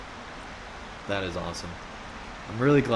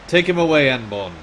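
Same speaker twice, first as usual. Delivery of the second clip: commanding shout; 2 semitones higher, 7 dB louder than usual. Acted. Captain orders the guard.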